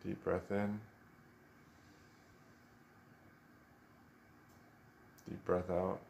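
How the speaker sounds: soft, unhurried meditation voice with long pauses; guided meditation. Real, not acted. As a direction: gently guide them into stillness, leaving space after each instruction.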